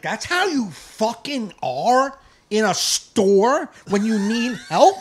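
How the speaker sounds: loud, high and slow; disbelieving scold; snapping back at a rude shopper; indignant retort. Retold or imitated. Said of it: "And I'm like".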